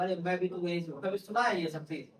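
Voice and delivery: ordinary delivery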